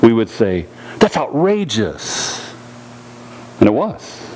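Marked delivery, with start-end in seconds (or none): 1.0-2.5 drawn-out, put-on outrage; slow, hammed-up indignation; mock outrage. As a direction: stretch it out slowly as if scandalised. Acting out indignant reaction to others.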